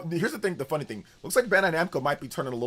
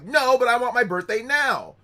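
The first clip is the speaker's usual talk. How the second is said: higher, louder, slow bratty demand; whiny kid tantrum; 9 semitones higher, 8 dB louder than usual. Retold or imitated. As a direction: wail it like a furious little kid. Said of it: "I'm like".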